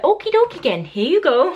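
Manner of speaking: put-on weak, high Minnesota accent on okie dokie; a self-admittedly bad Minnesota accent. Mimicking Donna's catchphrase, failed high Minnesota accent. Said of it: "I can't do the Minnesota accent".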